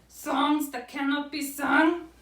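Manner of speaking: high, slow storybook lament; tearful Owl. A narrator's reading. Owl's eyes begin to water, high slow lament. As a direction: play an owl working himself up to cry. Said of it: "His eyes began to water".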